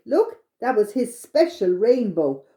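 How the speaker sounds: plain reading voice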